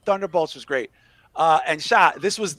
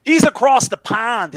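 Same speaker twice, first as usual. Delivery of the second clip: a slow, higher, loud Chicago drawl; Chicago accent; 7 semitones higher, 7 dB louder than usual. Pretend. Host teasing a viewer from abroad, in a Chicago accent. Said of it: "stop the accent".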